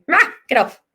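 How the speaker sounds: a hammed-up growl then a sharp get off; mock dog snap. Teacher voicing a hurt old dog snapping at kids. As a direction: play a hurt dog snapping at whoever pokes it. Dog being poked, snaps back.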